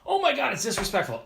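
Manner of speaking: high, indignant mock gasp; mocking outrage. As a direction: squeal it like an offended snob. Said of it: "to be like".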